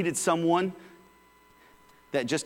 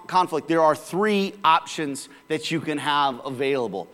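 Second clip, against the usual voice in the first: normal talking voice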